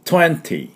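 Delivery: "twenty" with the T after N clearly released; fully pronounced T. Shown as a demonstration. Teacher showing careful pronunciation, twenty with clear T. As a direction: keep the second T in "twenty". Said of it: "ちゃんと発音するところです".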